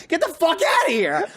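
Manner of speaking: high-pitched exasperated mock yell; retold yell. Gamer to sympathetic girlfriend, exasperated high yell. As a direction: act out chasing off unwanted sympathy. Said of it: "I tend to yell at them".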